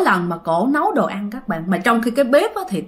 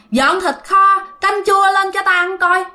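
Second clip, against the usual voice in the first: put-on much higher, bossy old-man order; 9 semitones higher than usual. Grandpa ordering daughter-in-law to bring rice, bossy. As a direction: command the daughter-in-law to serve food. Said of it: "Ổng nói rằng á là".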